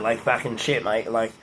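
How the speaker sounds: low, soft Australian "mate" banter; lightly done Australian accent. Shown as a demonstration. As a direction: put on a casual Australian accent, fading away. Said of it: "if I was in Australia though".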